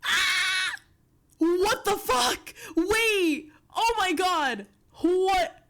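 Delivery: loud, high yelps of shock and delight; excited shouting. Real, not acted. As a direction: scream in real disbelief at the reveal. Screaming at first sight of model.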